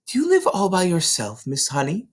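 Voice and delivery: gentle hushed question; whispered question. Acted. Matilda asks shyly.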